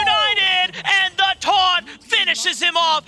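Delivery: high, loud, slow hype shout; excited shout. Real, not acted. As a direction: yell the big play out. Clutch play called live.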